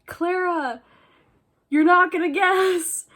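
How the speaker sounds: high, loud gush to a friend; acted-out giddy announcement; excited news. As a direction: burst out with big news. Breaking big news to friend.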